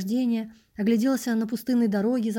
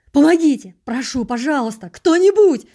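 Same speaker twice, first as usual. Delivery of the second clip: loud, higher pleading yells; desperate yelling; 5 semitones higher, 11 dB louder than usual. Acted. Narrator yells for help over the injured vagrant, loud. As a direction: scream for help like being cut. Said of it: "орал я так".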